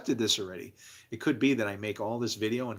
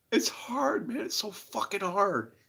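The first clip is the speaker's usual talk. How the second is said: high, strained through tears; tearful; 9 semitones higher, slower than usual. Real, not acted. To viewers, high and tearful. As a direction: still crying as he speaks. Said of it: "It makes me cry".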